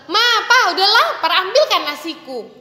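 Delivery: put-on impatient whiny child; rude demanding child. Shown as a demonstration. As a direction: demand it like a hungry brat. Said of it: "Itu kan tidak sopan".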